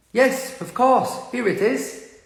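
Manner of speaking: high, slow, prim reply; sweetly polite English lady. Retold or imitated. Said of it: "en Inglaterra".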